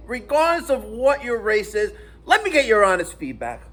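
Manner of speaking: normal talking voice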